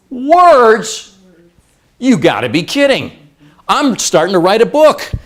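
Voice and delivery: loud, high cry of disbelief; mock outrage. Would-be author hears words barely count, loudly indignant. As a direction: exclaim it like you've been cheated.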